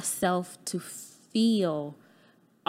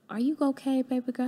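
a lightly higher mother's voice asking; mom's voice; 5 semitones higher, faster than usual. Retold or imitated. Mother checks on her daughter again, lightly higher mom voice.

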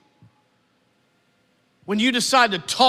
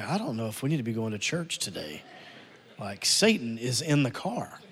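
low, quick, hushed worry; hushed kids' aside; 12 semitones lower, 10 dB quieter than usual. Retold or imitated. Children in the back seat murmuring to each other, hushed and quick. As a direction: murmur it to your sister, afraid. Kids whispering in tense car.

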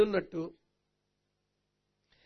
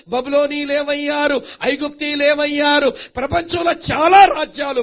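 chanted roll-call building louder and higher; impassioned preaching; 5 semitones higher, 9 dB louder than usual. Real, not acted. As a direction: drive the list up to a forceful peak. Preaching at rising force.

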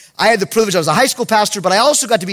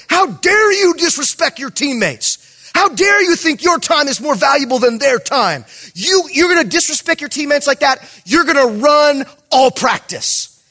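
raised, higher-pitched angry coach; screaming coach; 5 semitones higher than usual. Retold or imitated. Head coach to a kid late for practice, imitated angry yelling. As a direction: imitate a coach chewing out a player. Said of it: "He's screaming at this kid".

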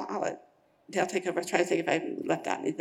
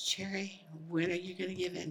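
soft, quiet retold appeal; gently pressing; 8 dB quieter than usual. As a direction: quote her softly, gently pressing. Wife's gentle confrontation, retold.

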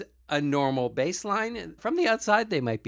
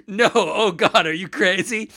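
laughing mock horror; 6 semitones higher, 7 dB louder than usual. Quoting Worf, laughing.

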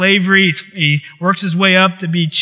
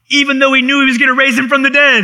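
much higher, driving the point home; emphatic rise; 8 semitones higher, 3 dB louder than usual. Acted. Preacher stressing Jesus knew he would raise Lazarus, rising high. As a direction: soar up on it.

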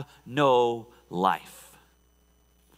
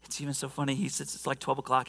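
usual speaking voice

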